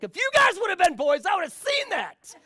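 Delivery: a high, loud blast of dad frustration; mock outraged yell. Dad driving turns to his waking daughters, loud high mock yell. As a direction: spin round and hammed-up shout it at the kids.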